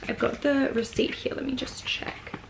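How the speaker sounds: soft unvoiced whisper to herself; whispered aside. Real, not acted. Vlogger checking her receipt, murmuring to herself in a whisper.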